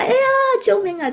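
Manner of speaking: high, mock-panicked call for help; retold cry for help. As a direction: squeal it like a scared wife in the kitchen. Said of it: "我就叫出来". (装作害怕地高声喊救命)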